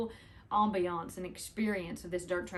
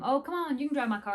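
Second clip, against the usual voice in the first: higher, louder imitation of friendly urging; coaxing; 7 semitones higher, 5 dB louder, faster than usual. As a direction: coax them, re-enacting the driver's offer. Retold coaxing by the driver.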